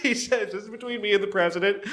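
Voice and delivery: amused, laughter-filled delivery; laughing retelling. Real, not acted. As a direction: struggle to repeat the quote through giggles. Retelling a quote while cracking up.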